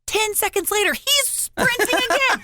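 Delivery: very high, incredulous storytelling; comic exasperation. Retold or imitated. Storyteller reporting the child sprinting again, comically exasperated. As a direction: squeal it out in mock despair.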